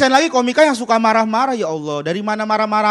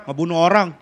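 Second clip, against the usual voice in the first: gruff inmate; 7 semitones lower than usual. Acted. Gruff inmate voice in jail skit.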